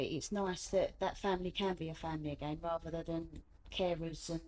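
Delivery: ordinary delivery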